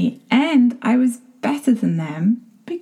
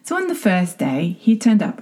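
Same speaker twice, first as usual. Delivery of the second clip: normal talking voice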